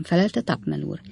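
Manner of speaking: plain reading voice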